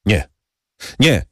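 sharp frightened cry of no; exclaimed refusal. A narrator's reading. Servant refusing the count, frightened cry. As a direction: blurt out no in panic. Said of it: "wykrzyknął Bertuccio".

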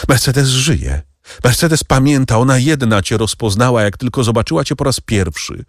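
wyższy, błagalny kobiecy głos pełen żalu; błagająca Mercedes. Acted. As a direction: zrozpaczona kobieta prosi dawnego ukochanego. Mercedes pleads with the Count. (Mercedes błaga Edmunda o litość, wyższym, rozedrganym głosem)

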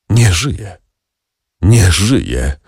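understated anguish, drawn out in a sigh; despairing sigh. A narrator's reading. Father sighing over his dead daughter, despairing. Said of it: "westchnął Villefort w paroksyzmie rozpaczy".

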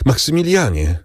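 high, urgent call, climbing on the repeat. A narrator's reading. Woman calls her departing beloved back, calling out. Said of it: "zawołała Valentin".

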